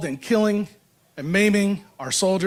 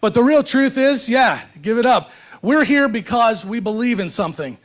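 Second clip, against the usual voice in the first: podium speech. Acted. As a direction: stir up the crowd from the podium. Rousing speech to rally crowd.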